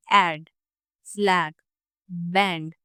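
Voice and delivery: ऐड, फ्लैग, बैंड with the mouth wide open on the a; careful demo of the open front vowel. Shown as a demonstration. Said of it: "थोड़ा सा wide open होता है".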